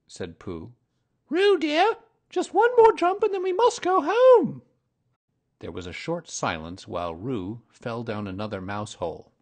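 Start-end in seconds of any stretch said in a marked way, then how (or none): 1.3-4.6 very high, louder motherly kangaroo voice. A narrator's reading. Kanga coaxes Baby Roo to jump once more, very high. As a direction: coax a little one in a high sing-song.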